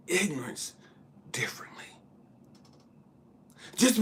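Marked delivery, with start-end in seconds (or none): 0.1-1.8 whisper. Real, not acted. Hushed aside.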